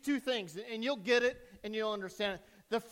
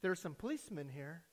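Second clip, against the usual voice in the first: very soft, low confiding voice; quiet child on the phone; small child's voice; 6 semitones lower, 10 dB quieter than usual. Acted. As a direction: speak hushed, like a little boy. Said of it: "silent voice said".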